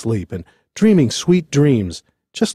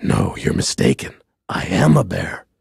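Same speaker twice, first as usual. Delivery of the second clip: low, meek bear voice; insistent bear; 7 semitones lower than usual. A narrator's reading. Bear corrects the general manager.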